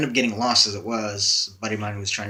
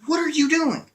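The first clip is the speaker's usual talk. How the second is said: much higher, loud yell of disbelief; retold yell; 5 dB louder than usual. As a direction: shout it in alarm, pitched way up. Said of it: "I yell at him".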